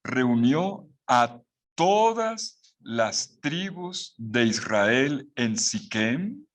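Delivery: very slow, stressing "todas"; slow careful reading. Shown as a demonstration. Teacher reading the verse slowly to the class. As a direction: read it word by word, leaning on "todas". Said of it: "Miren el texto con cuidado".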